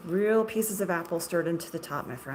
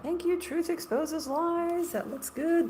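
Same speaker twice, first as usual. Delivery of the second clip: a light, higher marshmallow voice; 9 semitones higher, slower than usual. Pretend. Thanking chat in a lightly voiced marshmallow voice. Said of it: "make it like a marshmallow voice".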